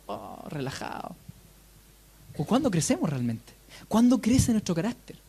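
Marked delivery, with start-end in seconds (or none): 0.1-1.2 mock relaxed sigh. Mocking comfortable growth in bed.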